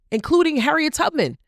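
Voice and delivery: light up on the name; excited. Real, not acted. Speaker praising women heroes, excited.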